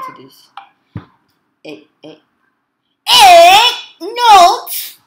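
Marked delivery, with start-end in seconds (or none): 3.1-5.0 very loud, high, drawn-out yell; all-out shout. Real, not acted. Player on a last try at the game, shouting. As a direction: belt both syllables at full force.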